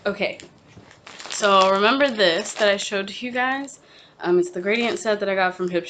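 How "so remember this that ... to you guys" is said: ordinary talk, nothing marked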